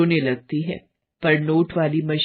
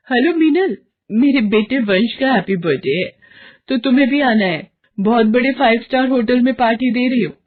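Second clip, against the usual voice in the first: higher, louder, fast bragging on the phone; boastful excitement; 6 semitones higher, 4 dB louder, faster than usual. Acted. Varsha bragging to friend Meenal about the party. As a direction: show off the five-star party.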